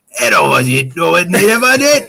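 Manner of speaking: gibberish in a put-on Cockney accent; slurred mock-Cockney babble; nonsense Cockney. Shown as a demonstration. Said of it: "do it like nonsense speaking".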